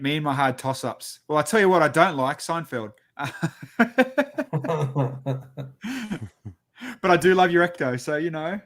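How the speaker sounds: ordinary talk, nothing marked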